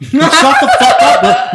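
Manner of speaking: high, slow, screamed insult; furious shout. Retold or imitated. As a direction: scream it out while laughing. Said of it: "guys will be like".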